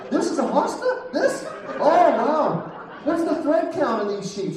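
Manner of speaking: high, overeager fake interest; hammed-up husband gushing over hostas and sheets; mock fascinated husband. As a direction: pretend to be thrilled by a plant.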